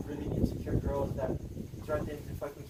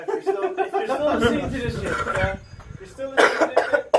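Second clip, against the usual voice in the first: laughing; 8 semitones higher, 9 dB louder than usual. Real, not acted. Laughing address to listeners.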